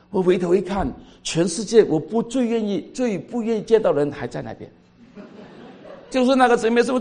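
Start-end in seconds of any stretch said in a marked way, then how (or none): none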